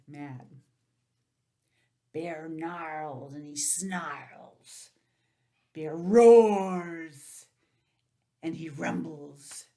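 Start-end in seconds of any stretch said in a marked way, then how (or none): 2.1-4.9 slow snarling storybook read; slow growly narration. A narrator's reading. Teacher reading the angry bear part, slow and growly. 5.7-7.5 loud roar. A narrator's reading. Teacher reading the bear's roar to children, loud.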